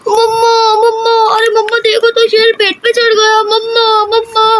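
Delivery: put-on high, wailing child voice calling for mother; children's mock yelling. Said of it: "चिल्लाने लग जाती हैं". (बच्चों की ऊँची घबराई पुकार)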